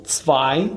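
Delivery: zwei drawn out very slowly with a rising end; German number two. Shown as a demonstration. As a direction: stretch out zwei slowly, as "tsvai". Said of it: "یک، اینس". (تلفظ zwei بسیار کشیده، «تسوای»)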